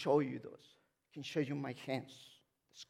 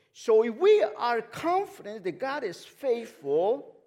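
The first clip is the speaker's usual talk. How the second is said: loud, high, emphatic pulpit delivery; full-force preaching; 8 semitones higher, 14 dB louder than usual. Real, not acted. Preacher asking church if God is faithful, loud and fervent. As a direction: preach it out big, stretching key words.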